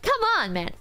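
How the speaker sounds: ordinary talk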